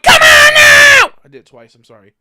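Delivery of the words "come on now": much higher, much louder, slow drawn-out scream; full-throated fed-up scream. Real, not acted. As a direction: scream it at the game. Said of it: "I didn't mean to fucking scream".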